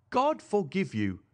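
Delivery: anguished cry. A narrator's reading. Mother to the daughter who spoke up, anguished cry. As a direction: cry out slowly in reproach. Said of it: "cried Madame Charpentier".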